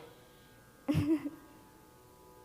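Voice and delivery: sheepish drawn-out laugh; embarrassed laugh. Real, not acted. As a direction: laugh at yourself after rambling. Nervous sister giving tribute.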